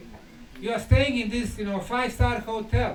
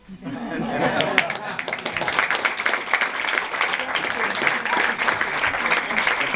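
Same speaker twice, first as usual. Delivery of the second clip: long breathy laugh at his own joke; amused chuckle rolling on; real laugh; 5 dB louder than usual. Real, not acted. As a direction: laugh it off, pleased with himself.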